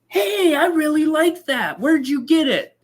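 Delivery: high, eager customer voice with a laugh; excited customer. Retold or imitated. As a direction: gush like a delighted buyer. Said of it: "they go".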